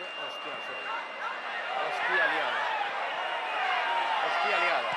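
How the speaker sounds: normal talking voice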